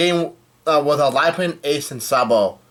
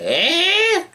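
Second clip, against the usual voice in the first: very high, squealed "eh"; big exaggerated "eh". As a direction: shoot way up into a mock squeal. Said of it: "there's a big fat".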